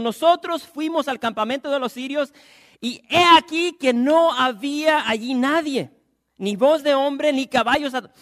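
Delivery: booming preacher reading aloud; fervent sermon reading; loud scripture reading. Acted. Preacher reads scripture loudly.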